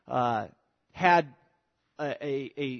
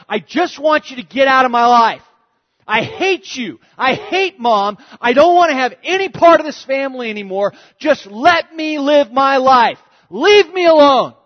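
rising from a higher, louder angry retort to a top-of-lungs yell; retold teen blowing up at his dad, higher and louder to the end; yelling at father; 7 semitones higher, 7 dB louder than usual. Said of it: "he yelled out at his father".

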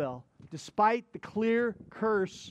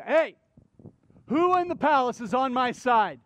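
loud call up to the window; 3 semitones higher, 5 dB louder than usual. Acted. Jehu shouts up at the palace window, loud call. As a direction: call out to anyone inside on his side.

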